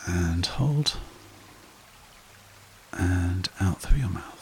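slow, calm meditation guide voice; guided meditation. Acted. Meditation host guiding listeners to relax, calm and unhurried. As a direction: ease the listener down into stillness.

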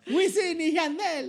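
mock reggaeton. Reggaeton hype.

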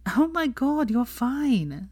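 high, exasperated brush-off; eye-rolling. A narrator's reading. Hermione brushes off Theo, high and exasperated. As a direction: roll your eyes as you say it. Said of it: "rolling her eyes".

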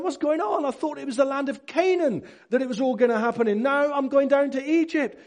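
high, loud protest of confusion; bewildered Jacob. Retold or imitated. Confused Jacob questioning the journey to Egypt, high and agitated.

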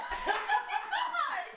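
very high, squealing laughter; real cackling laugh. Real, not acted. Cracking up at the Willy Wonka line, squealing laugh. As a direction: burst into high-pitched laughing.

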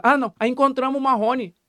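ordinary delivery

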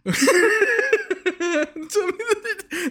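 high, squealing laugh at his own joke; real high laugh; burst of laughter. Real, not acted. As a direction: crack up high at your own bit.

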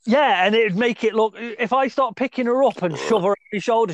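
ordinary talk, nothing marked

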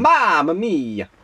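high, drawn-out cartoon Italian exclamation; milk each vowel in a hammed-up Italian accent; mock Italian accent. Co-host joking about Mario, hammed-up Italian accent.

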